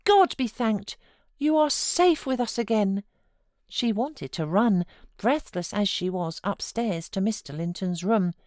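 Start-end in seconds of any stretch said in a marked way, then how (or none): 0.0-3.0 high, joyful, relieved reassurance; cried out. Acted. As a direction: comfort her with tearful joy. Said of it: "I cried".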